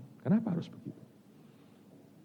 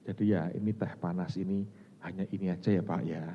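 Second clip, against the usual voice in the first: soft humble apology; 4 semitones lower, 7 dB quieter than usual. Retold or imitated. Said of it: "Terus dia ngomong begini".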